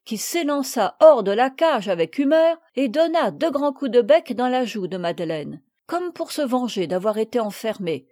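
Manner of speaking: usual speaking voice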